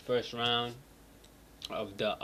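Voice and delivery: ordinary delivery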